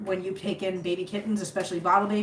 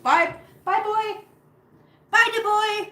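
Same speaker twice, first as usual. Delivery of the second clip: higher, louder goodbye coo; sing-song pet talk; 12 semitones higher, 5 dB louder, slower than usual. Real, not acted. To the departing cat, cooing sing-song goodbye.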